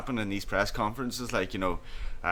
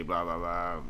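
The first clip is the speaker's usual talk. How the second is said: ordinary talk, nothing marked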